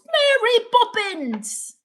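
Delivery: high, loud, drawn-out mock British cry; British accent. Streamer showing off Mary Poppins accent, exaggerated British. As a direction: call it out in a hammed-up British accent. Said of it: "my British accent is like Mary Poppins".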